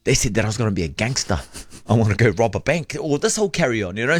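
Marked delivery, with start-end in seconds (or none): none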